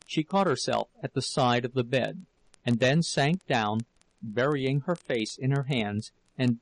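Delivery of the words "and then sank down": plain reading voice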